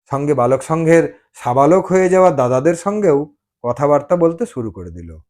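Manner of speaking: plain reading voice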